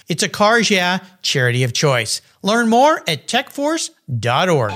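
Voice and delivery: polished promotional read with punched key phrases; radio sponsor spot. Acted. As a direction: sell the cause in lively, swooping announcer cadence. Reads a charity ad.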